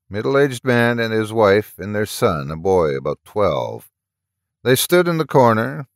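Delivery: ordinary delivery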